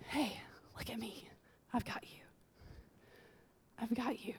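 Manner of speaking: soft unvoiced whisper as God comforting; tender whisper. God whispering comfort to a hurting listener. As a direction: breathe it gently, close and reassuring.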